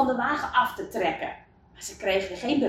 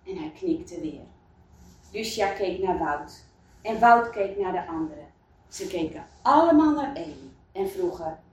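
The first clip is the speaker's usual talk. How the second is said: plain reading voice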